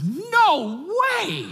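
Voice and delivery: very high, drawn-out comic amazement; astonished disbelief. Retold or imitated. Dad to daughter as the prayed-for song plays, astonished disbelief. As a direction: squeal it as if floored. Retold shock at answered prayer.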